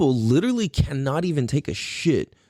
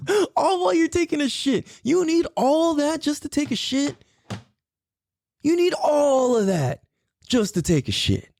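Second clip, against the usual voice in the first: high, disbelieving rant; you can't believe it; high incredulous disbelief; 13 semitones higher than usual. Real, not acted. Incredulous shouted question.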